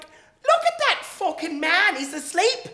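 high, slow, loud Irish exclamation; Irish accent. Retold or imitated. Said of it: "the Irish girl".

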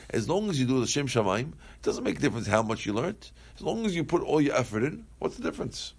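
ordinary delivery

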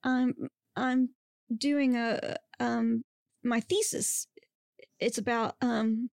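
halting, broken-up explanation; hesitant, trailing off. A narrator's reading. Student explaining her thesis to Bigfoot, hesitant, trailing off. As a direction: stumble between fillers and leave it hanging. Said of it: "she trailed off".

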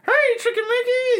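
squeaky high greeting. Retold or imitated. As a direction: chirp it way up high. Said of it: "that killed me when I was all like".

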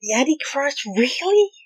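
incredulous question. Acted. Friend doubting an Antichrist claim, incredulous. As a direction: query it loudly, rising high on "really".

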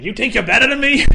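put-on thick Boston accent yelled at the coach. Imitating Happy beating up the coach in a thick Boston accent. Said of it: "in that thick kind of a Boston accent".

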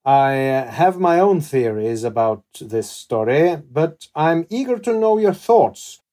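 measured, theatrical storytelling with emphatic peaks; dramatic story narration. Acted. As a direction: narrate it like a dark historical tale. Host reads scripted story to guest.

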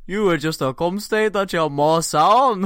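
put-on loud Scottish accent, cracking up. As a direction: spit it out like a Glasgow insult. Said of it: "read out in my best Scottish accent".

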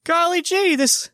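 put-on high, gee-whiz voice of an innocent kid; wholesome 1950s kid. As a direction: play a wide-eyed, old-timey kid. Goofy innocent reaction to trailer.

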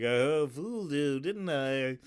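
Clouseau's put-on French accent; mock French accent. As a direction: imitate a hammy French accent. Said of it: "He's talking in that French accent".

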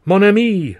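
joyful high cry; loud, happy French exclamation; cried out. A narrator's reading. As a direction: burst out with delight. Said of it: "Charlie cried".

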